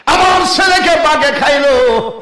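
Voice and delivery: loud, high-pitched outcry; calling out. Acted. Yakub calling out about his son and the tiger, loud cry. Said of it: "ডাক দিয়ে কয়".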